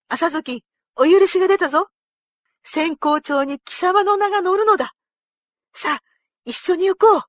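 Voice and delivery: high, loud, elated announcement; overjoyed. A narrator's reading. Retainer telling his beloved horse of the honour, overjoyed. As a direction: burst out with the good news.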